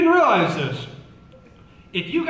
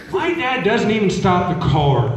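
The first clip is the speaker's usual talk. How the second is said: ordinary talk, nothing marked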